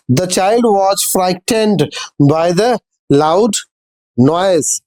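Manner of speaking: very slow, word by word dictation reading. Shown as a demonstration. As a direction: read each word slowly so students can write it down. Reads example sentences aloud. (धीमी, शब्द-दर-शब्द dictation वाली पढ़ाई)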